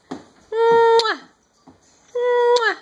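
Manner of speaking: kiss sound. Shown as a demonstration. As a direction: blow a big air kiss to the baby. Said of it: "blow me a kiss".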